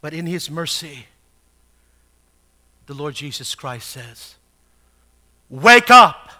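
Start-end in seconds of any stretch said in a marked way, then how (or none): 5.5-6.3 sudden loud shout; loud, commanding preacher's call; emphatic command. Acted. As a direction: shout it like an urgent wake-up order. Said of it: "I don't think he said that quietly".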